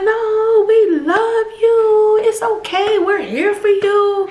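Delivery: high, slow and comforting with a catch of tears; tender reassurance. Retold or imitated. Church lady comforting the tearful mom-to-be, tender and reassuring. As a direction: soothe a crying young mother.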